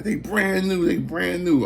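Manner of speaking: very high, delighted mock lady voice; excited lady. Happy buyer showing off her new shoes, high excited mock voice. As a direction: pitch way up and gush twice.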